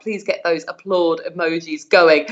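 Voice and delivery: usual speaking voice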